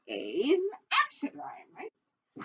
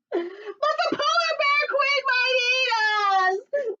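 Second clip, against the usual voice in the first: high, slow, tearful wail; 5 dB louder, much slower than usual. Acted. Frightened bird wailing to the fox about being eaten. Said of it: "wailed Lo-, Looney Little".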